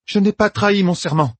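high, indignant cry; cried out. Acted. Meidani protesting to the sitters, crying out high. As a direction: protest your innocence. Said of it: "s'écria Meidani".

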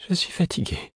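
high, small, trembling voice. A narrator's reading. The Fool tells Fitz he is tired, in a small trembling voice. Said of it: "fit-il d'une petite voix tremblante".

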